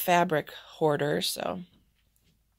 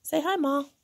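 pet talk; 8 semitones higher than usual. Real, not acted. Greeting the second dog.

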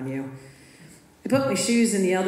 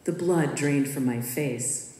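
ordinary delivery

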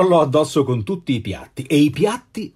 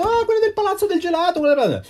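put-on much higher, fast child's voice; imitating his son; faster than usual. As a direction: play the eager little son, quick and high. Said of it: "mio figlio mi ha detto". (imitando il figlio piccolo, voce molto acuta e rapida)

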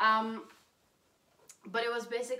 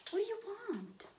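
very high, swooping pet voice; pet talk; 12 semitones higher, 4 dB quieter than usual. Real, not acted. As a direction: coo it sky-high to a dog. Cooing at her dog.

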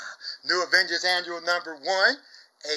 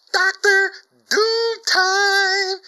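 much higher, louder, slow drawn-out call; hyped catchphrase; 7 dB louder, much slower than usual. Real, not acted. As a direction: stretch it out like a wrestling announcer.

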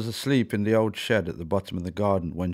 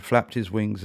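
ordinary delivery, nothing marked

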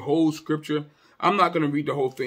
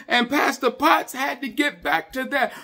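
preaching at full force; 9 semitones higher, 6 dB louder than usual. Real, not acted. Preacher proclaiming purpose and the cross, preaching at full force. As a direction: proclaim it to the whole church.

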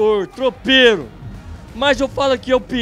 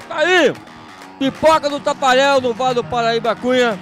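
hyped rodeo announcer; 3 semitones higher, 4 dB louder than usual. Real, not acted. Host hypes the popcorn stand.

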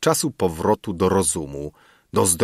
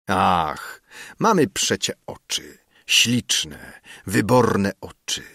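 ordinary delivery

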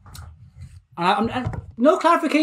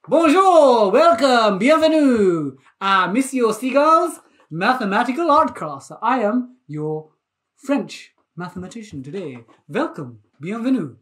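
put-on French accent; 4 dB louder than usual. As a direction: play a French art teacher, admittedly badly. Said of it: "I will not continue with my French accent".

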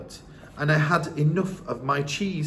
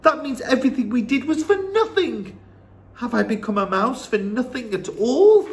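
put-on high, distressed boy voice; boy aghast at the news; dismayed young grandson; 10 semitones higher, 4 dB louder than usual. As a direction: protest in dismay, pitched up.

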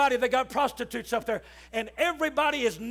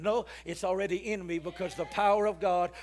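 normal talking voice

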